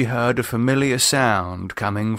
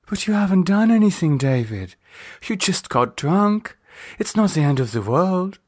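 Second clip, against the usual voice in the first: reassuring; 8 semitones higher than usual. Acted. Lisa reassuring.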